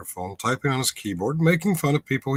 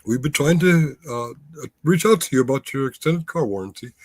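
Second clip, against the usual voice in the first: higher, louder put-on Indian accent, badly done; mock Indian telemarketer accent; 5 semitones higher, 4 dB louder than usual. Host mocking a scam call with a clumsy Indian accent. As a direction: play a scam caller in a rough Indian accent. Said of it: "I'm probably really fucking that up".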